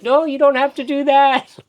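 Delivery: hammed-up meditation master, high and loud; imitating the master. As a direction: squeal it like a startled teacher. Meditation master answers him, retold.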